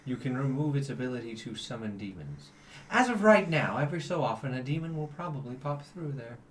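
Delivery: measured, grave and patient; celestial angel. Acted. GM voices the celestial.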